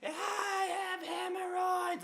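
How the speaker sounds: loud, very high mock scream; hammed-up falsetto shriek; imitated wailing neighbor. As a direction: wail it slowly as the pained neighbor. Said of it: "and she's like".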